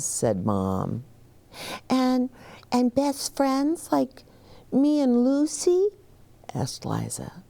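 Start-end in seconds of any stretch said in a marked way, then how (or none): 1.9-5.9 lightly voiced little girl. Child Liza voiced.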